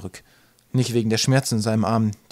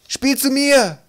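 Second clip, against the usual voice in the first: high, loud yell; bellowing; 7 dB louder, much slower than usual. A narrator's reading. Hodder grob to Twig across the field, bellowing. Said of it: "hörte er jemanden brüllen".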